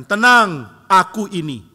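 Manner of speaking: loud call across the water; loud, high calming call; Jesus calling over the storm. Retold or imitated. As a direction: shout reassurance over the waves. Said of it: "Tapi Tuhan mengatakan".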